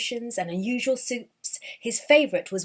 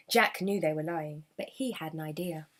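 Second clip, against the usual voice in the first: ordinary delivery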